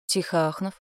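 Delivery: usual speaking voice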